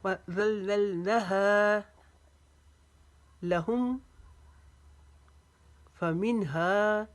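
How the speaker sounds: slow Quran recitation, long drawn vowels; recitation with pauses. Shown as a demonstration. Teacher reciting the verse for students, slow tajweed with stops. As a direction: recite phrase by phrase, stopping after each. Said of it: "वक्फे के साथ पढ़ेंगे".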